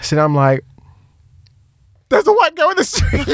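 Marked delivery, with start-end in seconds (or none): 2.1-3.3 very high comic cry for attention; mock alarm cry. Man trying to get police attention, mock alarm cry. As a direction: raise a hammed-up high-pitched alarm.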